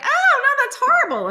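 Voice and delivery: hammed-up horrified director; mock director. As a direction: director hates the second take. Director rejects the take.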